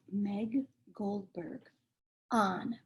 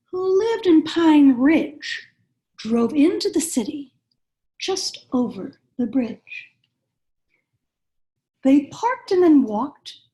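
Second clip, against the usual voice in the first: lively picture-book read-aloud, rhyming bounce; reading to children; 3 semitones higher, 6 dB louder than usual. A narrator's reading. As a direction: read it like bedtime story time for little kids.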